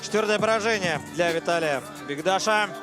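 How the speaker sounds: ordinary delivery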